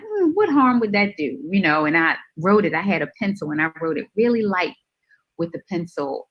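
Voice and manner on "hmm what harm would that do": higher, louder mock innocent musing; tempted shrug. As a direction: talk yourself into it with a shrug. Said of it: "I'm like".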